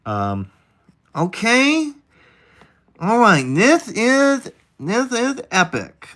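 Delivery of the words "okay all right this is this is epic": high, slow nasal-free nerdy put-on voice; put-on nerd voice. Streamer trying out a nerdy voice for his chat, high and slow. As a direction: pitch way up and drawl it like a know-it-all. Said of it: "I'm gonna cycle through voices".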